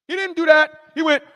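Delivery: usual speaking voice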